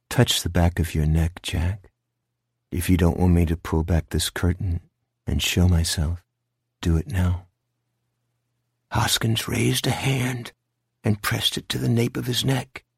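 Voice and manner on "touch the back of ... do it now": lightly voiced low sinister creature; low, controlled threat; menacing monster. Figure behind curtain threatens Jack.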